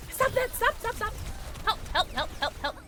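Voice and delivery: high, repeated cries for help; playful panic. Real, not acted. Gamer being attacked in play, panicked yelps. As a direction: yelp urgently as if under attack.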